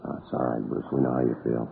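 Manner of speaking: gently reassuring. Real, not acted. Detective comforting a crying witness.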